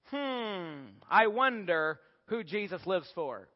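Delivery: slow, musing hum and question; mock pondering. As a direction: pretend to puzzle it out slowly. Mock pondering by cat believer.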